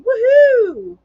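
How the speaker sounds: high, loud celebratory whoop; excited cheer. Real, not acted. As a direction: let out a big, ringing woohoo. Cheering at finally being in focus.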